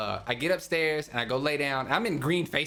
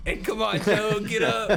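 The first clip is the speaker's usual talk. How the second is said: higher, louder, slow coaxing; mock nagging friend; 6 semitones higher, 5 dB louder than usual. Marshall kicking him awake upstairs, hammed-up slow nagging. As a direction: drag it out like a pushy buddy.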